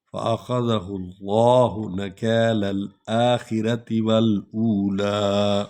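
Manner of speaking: slow, chanted Quran recitation; slow melodic recitation; tajweed recitation. Shown as a demonstration. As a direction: intone each verse slowly, phrase repeated. Final recitation.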